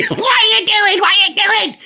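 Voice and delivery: high, loud outraged shriek; mock shriek like an outraged mum; imitating a furious mum. Angry mum shouting at son.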